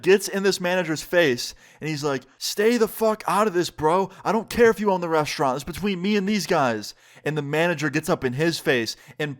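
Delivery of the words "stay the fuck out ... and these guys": higher-pitched, heated mock confrontation; acted angry warning. Jared gets in the restaurant manager's face, heated retelling. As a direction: imitate someone angrily warning off an intruder.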